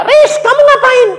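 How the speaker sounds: high-pitched, loud acted back-and-forth; hammed-up household shouting; loud exchange. Acted parent-son exchange over shouting.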